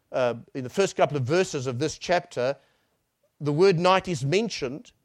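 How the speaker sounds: ordinary talk, nothing marked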